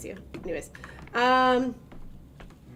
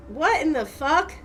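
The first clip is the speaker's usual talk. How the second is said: startled outburst; 9 semitones higher, 6 dB louder, slower than usual. Real, not acted. Startled by something in the game.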